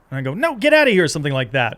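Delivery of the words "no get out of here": high, loud brush-off; loud "get out of here" to a wedding crasher; curt refusal. Retold or imitated. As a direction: shoo him away firmly. Acting out refusal in scene.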